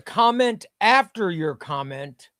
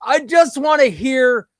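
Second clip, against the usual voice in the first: frustrated high shout, much louder; going crazy; 11 semitones higher, 8 dB louder than usual. Real, not acted. Host yelling at his broken stream setup, frustrated shout. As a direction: blurt it out at the top of your voice, exasperated.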